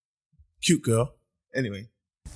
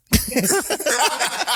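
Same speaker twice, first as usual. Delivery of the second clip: high burst of laughter; 4 dB louder than usual. Real, not acted. Big laugh after punchline.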